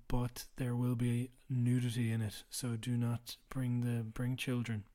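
ordinary talk, nothing marked

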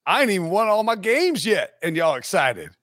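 mock Southern boast; fake Southern accent. Coach trying to brag to the crowd, fake Southern accent. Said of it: "like a fake Southern accent".

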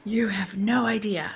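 hushed, breathy whisper. Acted. Casting director confides to the podcast host in a whisper. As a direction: lean in and whisper the confession.

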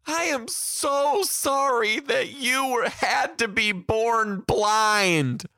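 loud, high, drawn-out wail; bawling child. Retold or imitated. Samuel pitying his supposedly blind teacher, mock bawling. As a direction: imitate a kid sobbing out each stretched word.

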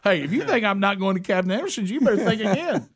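high-pitched, worked-up insistence; mock defiant vow. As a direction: insist in playful outrage, voice pitched right up. Retells defiant vow to wife.

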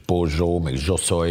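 "pozsó" with a zh sound where standard Spanish has y; Rioplatense zh sound. Shown as a demonstration. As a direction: say "pozsó" and "zsoszolj" with a buzzing zh like Argentines. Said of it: "Ez a zsézős".

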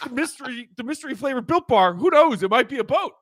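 high, loud excited pitch; excited hype. Real, not acted. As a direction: hype it up like a reveal.

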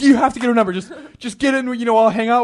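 imitating an animated friend, loud and high; Kyle. Friend pushing him to get her number, animated. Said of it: "he gets very, like".

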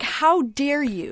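retold protective outrage, drawn out; mama bear indignation. Defending that guy's dream, retold mama bear indignation. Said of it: "I went mama bear".

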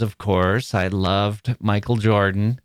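normal talking voice

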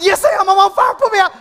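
high-pitched mock panic; frantic insistence. Argues frantically that he's burning.

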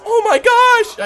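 high, loud imitated squeal; mock-overjoyed exclamation; thrilled recognition. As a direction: shriek it like a girl at a reunion. Girl greets supposed old friend.

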